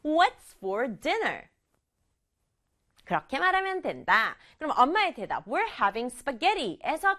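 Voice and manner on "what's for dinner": slow with dinner hit hard; stress on dinner. Shown as a demonstration. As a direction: hit the word dinner, slowly. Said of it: "한 번 더". (저녁, dinner를 강조해서 천천히)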